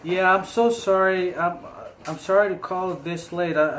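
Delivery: normal talking voice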